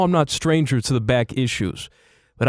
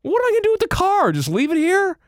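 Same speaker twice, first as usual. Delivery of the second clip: high panicky. Real, not acted. Panicked about the car.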